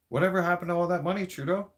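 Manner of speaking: fast, high demanding question; rapid demand. Real, not acted. Demanding the missing money from Trudeau, fast. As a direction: rattle off the accusation.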